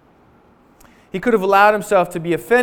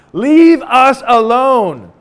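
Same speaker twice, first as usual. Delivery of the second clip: drawn-out, loud mock wail; mock cry; 4 semitones higher, 9 dB louder, much slower than usual. Israelites quoting their plea to Moses, loud slow wail. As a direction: stretch it slowly like a desperate plea.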